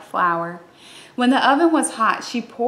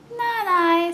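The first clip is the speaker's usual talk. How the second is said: much higher, squeaky mouse; tiny mouse voice; 9 semitones higher than usual. Acted. Mouse refuses.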